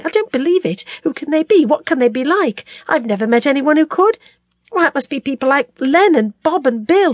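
high-pitched, distressed disbelief; appalled and disbelieving, voice held high; incredulous monologue. A narrator's reading. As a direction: ask in anguish how anyone could. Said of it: "that was perpetually incredulous, began the monologue".

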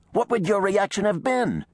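high, heated demand; inflamed. A narrator's reading. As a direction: fire the question back in outrage. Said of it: "was equally inflamed".

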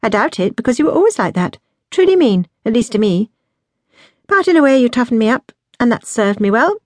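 brisk, outspoken younger sister; blunt. A narrator's reading. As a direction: tell her bluntly, no softening. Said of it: "replied in her normal blunt manner".

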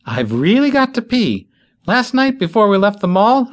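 put-on high, urgent young woman; high urgent plea; desperate. A narrator's reading. Bitsy desperate to pee.

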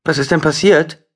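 put-on high, loud anxious girl's question; a girl suddenly scared for her dad; worried young girl. A narrator's reading. Jola asks the doctor anxiously about her father, high and loud.

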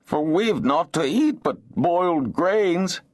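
high, plaintive whine; self-pitying, high-pitched complaint. A narrator's reading. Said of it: "His voice changed to a plaintive whine".

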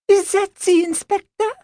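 high, alarmed French lady; French accent, sudden alarm. Acted. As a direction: ask anxiously in a French accent. Nanette alarmed.

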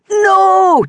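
a big, loud, high bellow. A narrator's reading. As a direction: roar it to stop her. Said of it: "Geordie bellowed".

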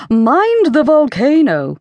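high, slow warning call. A narrator's reading.

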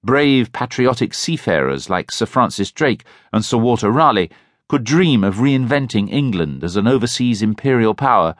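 usual speaking voice

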